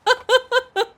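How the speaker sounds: quick high giggle; high laugh. Real, not acted. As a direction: giggle at the cute face. Laughs at Paimon's face.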